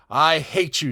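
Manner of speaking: put-on a child flinging hate at a grown-up; furious choked cry. A narrator's reading. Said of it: "she cried in a choked voice".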